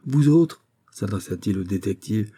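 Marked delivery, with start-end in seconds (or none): 0.1-0.7 loud, angry order; furious. A narrator's reading. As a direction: snap an order with authority. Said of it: "dit-il furieux". (ordre furieux, voix forte)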